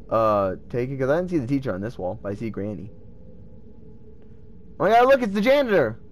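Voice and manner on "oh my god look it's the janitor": high-pitched, delighted outburst; excited recognition. Real, not acted. Gamer spots the janitor in the game, excited recognition. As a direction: blurt it out on spotting a familiar face. Spots another familiar character.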